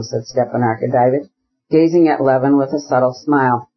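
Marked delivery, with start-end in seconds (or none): none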